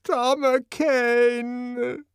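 high, slow, grief-stricken call; anguished cry. Acted. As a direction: stretch the name out in agony. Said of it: "the grimace of extreme grief".

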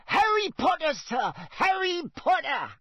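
very high-pitched elf squeal; piercingly high, excited little creature. A narrator's reading. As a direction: squeal it in delight like a tiny elf.

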